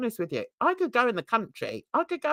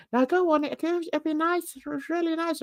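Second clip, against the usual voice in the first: a hint of an Irish ex urging her to drink; light Irish lilt; 7 semitones higher than usual. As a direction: coax her gently with a touch of Irish. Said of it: "That's how he spoke".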